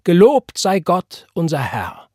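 high, loud, slow praise; ringing call that settles at the end. A narrator's reading. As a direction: cry it out in jubilant worship. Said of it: "rief Elisha".

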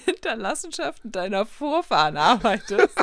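laughing through the words. Real, not acted. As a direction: giggle it out in disbelief. Laughing at phrasing.

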